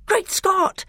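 very high astonished exclamation; excited cry. A narrator's reading. Edmund watching his brother survive the blow, very high cry. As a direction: cry out in amazed relief. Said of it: "cried Edmund".

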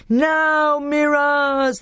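high, drawn-out urging yell; crowd yell. A narrator's reading. Telmarines urging Miraz on as Peter falls, high yell. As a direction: roar your champion on. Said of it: "they yelled".

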